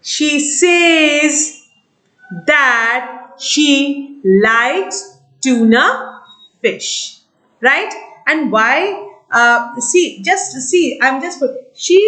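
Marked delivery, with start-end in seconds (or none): 0.0-7.2 slow narration. Shown as a demonstration. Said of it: "I will narrate to my friend".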